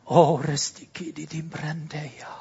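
breathy whispered glossolalia; whispered tongues. Real, not acted. Pastor praying in tongues under his breath, whispered.

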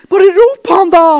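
hammed-up urging shout. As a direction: egg him on.

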